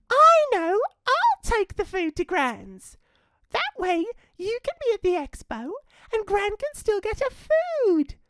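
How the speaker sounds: bursting with a bright idea; eager little girl Red. Acted. Red offers to take food to Gran, eager high girl voice. Red's voice in story.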